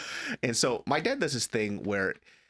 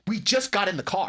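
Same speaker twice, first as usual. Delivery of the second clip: louder, higher-pitched protest; exasperated retort; 8 semitones higher, 4 dB louder than usual. Retold or imitated. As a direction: snap back in exasperation at dad.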